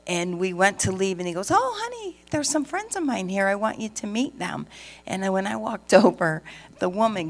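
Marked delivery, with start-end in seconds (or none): 1.5-4.7 high, sugary call of honey, fading back down; sweet husband voice. Retold or imitated. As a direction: playfully mimic a doting husband. Husband voiced in retold story.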